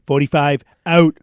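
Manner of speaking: a bold, punchy Trump exit line; Trump sign-off. Retold or imitated. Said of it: "The Trumpster".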